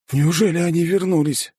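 doomed. A narrator's reading. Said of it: "обречённо спросил".

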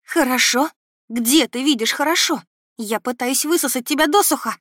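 high, loud, incredulous outburst; horrified. A narrator's reading. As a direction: throw it back at him in horror. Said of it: "Ужаснулась я". (с ужасом, срываясь на крик)

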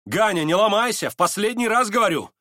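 high, insistent call; urgent warning. A narrator's reading. Настасья Филипповна warns Ганя a last time, urgent call.

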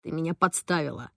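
put-on boy muffled behind glass; muffled through glass. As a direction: talk from behind a car window. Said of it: "Ромкин голос звучал глухо из-за стекла".

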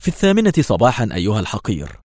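ordinary delivery

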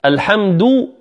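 "الحمد" slowly, ending on full "duu"; rounded final damma. Shown as a demonstration. Teacher has students hear the difference, rounded "du" in "الحمد". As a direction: round the lips on the last vowel of "الحمد". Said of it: "بإخراج الشفتين".

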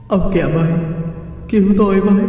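high, pained begging; weeping plea. A narrator's reading. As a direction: pitch climbing into a wail. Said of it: "Vong còn lại cũng khóc lóc".

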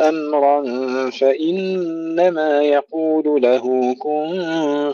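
slow, high, full melodic tajweed chant; Quran recitation. Acted. Teacher recites the verses for students, slow chant. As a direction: recite each phrase slowly and ringingly.

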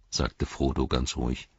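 ordinary delivery, nothing marked

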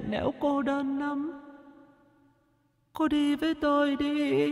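a dead maid's eerie, far-off plea; ghostly murmur. A narrator's reading. Dead maid beckoning her mistress in a dream, soft high ghostly wail. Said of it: "miệng khẽ lẩm bẩm".

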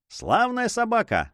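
jumps up high in delight; exclaiming. A narrator's reading. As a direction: cheer the dog, pitched well up. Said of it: "вскричал Шелковинка".

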